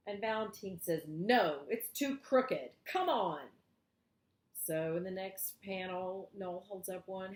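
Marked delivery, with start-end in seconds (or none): 1.1-3.4 louder, fussy no, slowing on crooked; Valentine's loud refusal. Acted. Valentine loudly refuses the crooked stick Noel offers. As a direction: push the stick away with a loud no.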